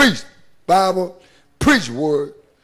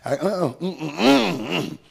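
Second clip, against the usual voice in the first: a soft crying uh-uh, mm-mm; holding back tears while remembering his restraint; choked-up hum; 4 semitones lower, 7 dB quieter, much slower than usual. Real, not acted. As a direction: swallow the words back with a catch in the throat. Choking up recalling holding tongue.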